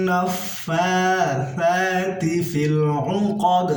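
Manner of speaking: slow, melodic chanted recitation; reciting Surah Falaq for students; Quran recitation. Shown as a demonstration. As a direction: stretch each word in chant. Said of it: "ধাক্কা দিয়ে পড়তে হবে".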